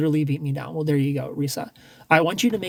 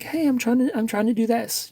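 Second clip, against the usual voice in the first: higher, coy asking for advice; lightly hammed-up sweet request; mock sweet ask; 7 semitones higher than usual. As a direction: play innocent and eager to learn.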